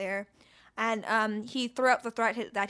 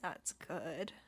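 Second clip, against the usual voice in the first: whisper; 5 semitones lower, 14 dB quieter, much slower than usual. Real, not acted. Whispered comment.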